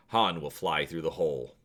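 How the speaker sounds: ordinary delivery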